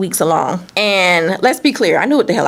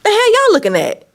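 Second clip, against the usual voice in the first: higher, snapping challenge, a touch louder; angry confrontation; 3 dB louder than usual. Retold or imitated. Confronting her friend and No Good caught on the stairs, angry high challenge. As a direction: demand to know what they're staring at. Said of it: "Just like that".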